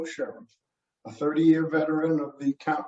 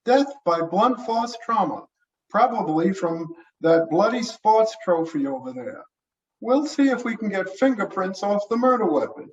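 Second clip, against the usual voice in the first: higher-pitched, businesslike medical examiner; brisk medical examiner; 5 semitones higher than usual. Acted. Examiner briefing the sheriff on the body, higher businesslike voice. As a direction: report the findings in a raised character voice.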